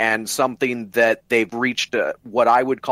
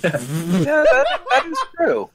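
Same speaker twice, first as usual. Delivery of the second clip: laughing; 11 semitones higher than usual. Real, not acted. Laughing reply to friends' joke.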